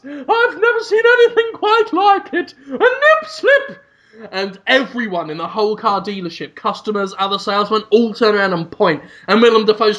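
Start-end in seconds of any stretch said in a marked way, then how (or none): 0.0-3.8 high, hammed-up cry of shock; mock scandalized shriek. Onlooker pointing out a nip slip, mock scandalized shriek.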